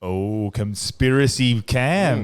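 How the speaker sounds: slow, drawn-out mock-ominous announcement; hammed-up segment intro; mock dramatic. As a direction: announce it in stretched-out pretend suspense.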